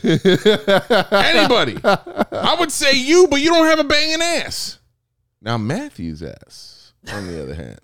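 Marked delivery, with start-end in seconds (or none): 0.0-4.8 loud high laughing rant; laughing shout. Real, not acted. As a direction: shout it while cracking up.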